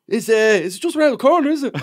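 put-on high, whiny voice of a tired kid; tired teenage boy. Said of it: "He was like".

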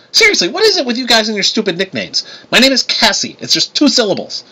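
high, indignant complaint; exasperated. A narrator's reading. Cassie objecting to being called little lady, exasperated. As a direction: push back hard on the nickname. Cassie bristles at her nickname.

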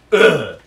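disgusted groan. Real, not acted. Disgust at the bad book.

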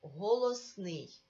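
Голосний said slowly and firmly; emphatic answer. Shown as a demonstration. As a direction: spell out Голосний slowly like a teacher. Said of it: "Який звук позначають ці букви?".

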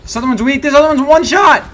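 loud, high, disbelieving yell; shouting. Real, not acted. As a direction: yell it out in shock. Sudden firefight, enemy survives.

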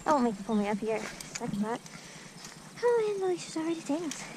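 ordinary talk, nothing marked

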